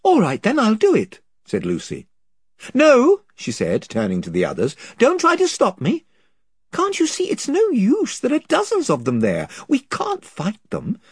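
lightly voiced girl, confused; young girl Lucy. A narrator's reading. Lucy decides.

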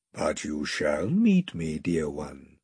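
low, slow and soft; tender reassurance. A narrator's reading. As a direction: comfort her tenderly. Aslan reassuring.

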